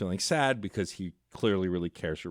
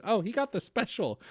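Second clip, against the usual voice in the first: lightly voiced calm waitress, higher; a breezy unbothered waitress; 11 semitones higher than usual. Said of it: "I love how calm the waitress is".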